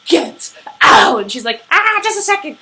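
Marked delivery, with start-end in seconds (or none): 0.1-1.2 loud mock-angry shout. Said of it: "He's all angry". 1.7-2.6 high, loud mock yell; calling back. Quoting her unfazed yelled reply.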